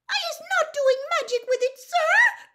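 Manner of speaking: very high, fast, loud squeal; Winky. A narrator's reading. Accused house-elf denies magic to the wizard, frantic squeal. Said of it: "squealed Winky".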